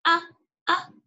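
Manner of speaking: short, separate "أ" with an a vowel; alif with fatha. Shown as a demonstration. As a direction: sound out "أ" as a brief glottal a, pausing between. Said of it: "حرف الألف مع الفتحة". (نطق "أ" بالفتحة مقطعًا قصيرًا)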